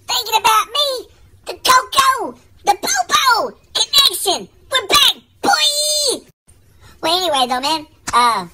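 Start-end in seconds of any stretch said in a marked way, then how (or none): 0.1-6.3 excited. Acted. Buddy greeting his old pal, overjoyed.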